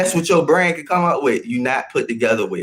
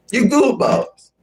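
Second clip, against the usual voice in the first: a high, mocking squeal; high teasing squeak; 9 semitones higher, slower than usual. Streamer teasing chat in a high mock voice. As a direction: pitch You goofballs way up, playful. Streamer tries the line two ways.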